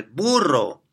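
mock roadside shout; retold shout. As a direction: call it out like a driver yelling in passing.

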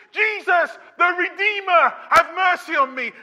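full shouted plea, high and loud; the blind beggar shouting to be heard over the crowd; desperate shout. Acted. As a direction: shout for mercy with everything you have. Peak of the acted beggar's cry.

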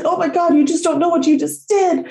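high, emotional outpouring; retold overwhelmed thanks. Dog owner thanking rescuer, retold overwhelmed gratitude. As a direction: pour out amazed gratitude in a high pitch.

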